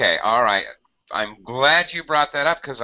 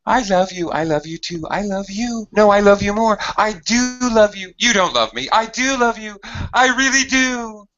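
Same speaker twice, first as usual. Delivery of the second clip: higher, sing-song mock argument; playful lovers' quarrel; 12 semitones higher than usual. Shown as a demonstration. Teacher acts out a couple insisting, higher and playful. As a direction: bicker sweetly over who loves more. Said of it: "Emphasis. It adds emphasis".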